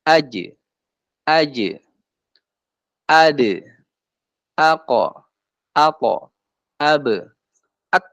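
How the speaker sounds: each of "aj, ad, ako, apo, ab, at" stopped, most with a small bounce; held, stopped letters. Shown as a demonstration. Teacher demonstrates held letters, each syllable stopped short. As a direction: block the sound of "aj, ad, ako, apo, ab, at", then release briefly. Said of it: "Nah, ketahan".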